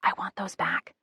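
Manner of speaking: breathy whisper leaning forward. A narrator's reading. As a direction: whisper it urgently across the counter. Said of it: "I whisper".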